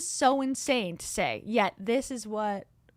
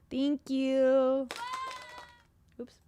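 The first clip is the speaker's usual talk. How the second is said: normal talking voice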